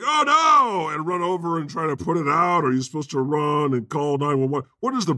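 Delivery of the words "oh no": higher, louder mock panic; mock alarmed oh no. Imagining the employee's reaction, a mock panicked oh no. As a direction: yelp it like a fake panic.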